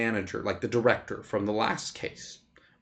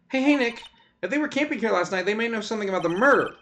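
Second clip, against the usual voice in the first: high, excited sidekick voice; eager assistant; 10 semitones higher, faster than usual. Acted. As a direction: play the girlish assistant, pitched up.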